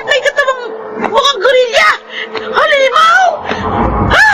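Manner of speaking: high, crying shrieks of panic; terrified screaming. Acted. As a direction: scream as the beast comes through the door. Wife alone, monster bursts in.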